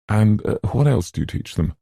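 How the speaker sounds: courteous, lifted enquiry; polite. A narrator's reading. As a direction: ask with courteous interest. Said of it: "Theodora asked politely".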